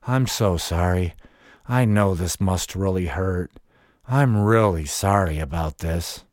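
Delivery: low-pitched, calm and unhurried quoted voice; calm apology. Retold or imitated. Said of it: "saying in a calm voice".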